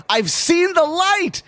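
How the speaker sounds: high, drawn-out cry of conversion; mock fervent proclamation. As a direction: proclaim it high and slow, hammed-up. Acting out Looney's insistence.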